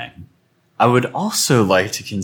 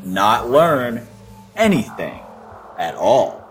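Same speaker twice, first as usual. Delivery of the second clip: lively, higher storytelling read over music; story narration; 8 semitones higher, 4 dB louder than usual. A narrator's reading. Podcast host narrating a story to listeners, lively. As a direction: narrate the fable with energy.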